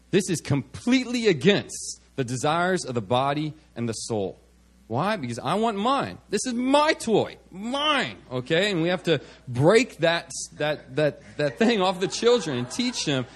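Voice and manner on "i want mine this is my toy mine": lightly voiced selfish kid, higher; mock childish possessiveness; possessive child. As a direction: claim the toy like a small child. Voicing a selfish child over toys.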